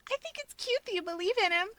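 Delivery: put-on high, sweet girlish coo. A narrator's reading. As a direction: coo it fondly like a teasing friend. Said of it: "Rika coos".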